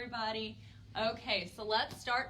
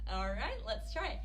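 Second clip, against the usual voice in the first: ordinary talk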